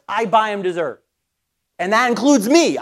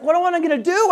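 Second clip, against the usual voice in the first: higher-pitched imitated exasperation; mock frustration; 8 semitones higher than usual. Son missing his chance with the waiter, mock frustrated. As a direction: act out a frustrated complaint. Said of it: "he was getting so frustrated".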